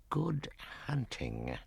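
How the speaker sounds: slow, soft, grim send-off; grim snake farewell. Acted. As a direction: drawl it like a grim old python. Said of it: "said Kaa grimly".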